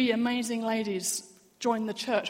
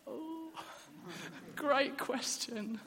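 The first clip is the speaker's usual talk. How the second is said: awkward laugh; 5 semitones higher, 6 dB quieter, much slower than usual. Retold or imitated. Awkward answer to a friend.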